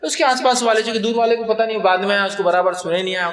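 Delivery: ordinary talk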